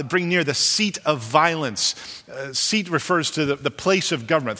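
ordinary talk

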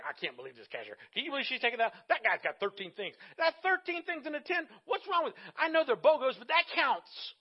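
a whiny complaining customer, rising pitch; 1 mock griping shopper. Shopper griping to the guy behind, mock whiny.

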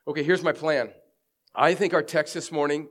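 ordinary talk

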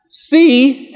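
"see" punched high and loud; stressed see. Shown as a demonstration. Preacher driving home the point: "see" punched high. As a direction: hit "see" hard and high.

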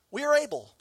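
mock boast. Repeats disciples' boast.

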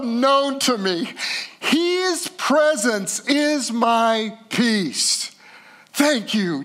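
high-pitched preaching at full pour, key words drawn out; impassioned preaching. Real, not acted. Pastor declaring to the congregation that Jesus is his peace, fervent preaching. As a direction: build to a high, drawn-out cry of faith.

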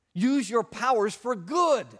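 loud, slow rallying cry; preacher's punchline shout; emphatic call. Real, not acted. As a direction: urge them, big and slow.

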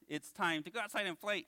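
puppet voice, pitched above the ventriloquist's; eager puppet proposing playtime; Bones. Pretend. Puppet interrupting his ventriloquist, eager puppet voice. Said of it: "I'm your ventriloquist".

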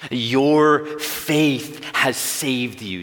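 loud, high, very slow Your faith has saved you; emphatic proclamation. Shown as a demonstration. Pastor answering his own question, loud and slow. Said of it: "Your what?".

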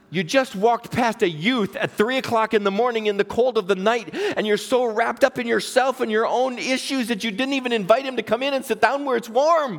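high, emphatic scolding; acted-out telling-off, driving each point home; stern rebuke. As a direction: build to an incredulous reproach. God rebukes the youth minister.

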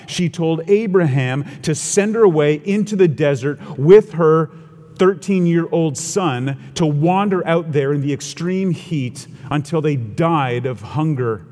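normal talking voice

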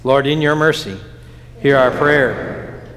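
ordinary talk, nothing marked

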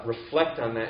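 ordinary talk, nothing marked

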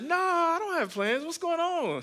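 put-on, very high sing-out; feigned willingness. Husband answering wife about Friday plans, falsely agreeable high voice. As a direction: pitch it way up, hiding the reluctance. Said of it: "Uh, but I said".

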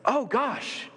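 high, amazed realization; preacher voicing his own discovery, high and amazed; sudden realization. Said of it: "it's like".

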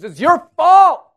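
loud, high, slow blame; mock accusing shout; accusing cry. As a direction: hurl the blame like a mob. Israelites blaming Moses, acted.

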